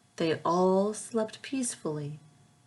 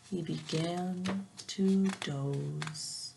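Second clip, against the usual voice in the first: drowsy slow; 2 semitones lower, 6 dB quieter, much slower than usual. Acted. Drifting off to sleep.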